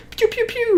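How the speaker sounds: high mock laser blasts; laser noises. Telling a friend about cartoon robot fights, high mock laser pews.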